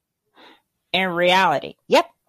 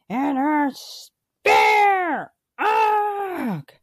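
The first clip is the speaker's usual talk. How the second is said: booing fan; 4 semitones higher, slower than usual. Real, not acted. Booing the show in outrage.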